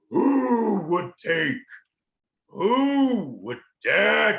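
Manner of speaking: huge, slow dragon roar with drawn-out words; booming ancient dragon. Acted. An ancient dragon challenges intruders in the caldera, booming and slow. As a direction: boom it out loud, stretching each word. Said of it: "it booms out".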